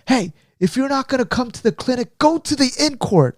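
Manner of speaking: high-pitched scolding, imitated; harassing order, acted out without shouting; pissed-off coaches. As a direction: mimic an irritated coach sending players away. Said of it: "the coaches got pissed".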